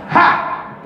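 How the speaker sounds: single abrupt "ha" bark; imitated guttural laugh. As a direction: bark out one lone laugh. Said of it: "Just that one noise".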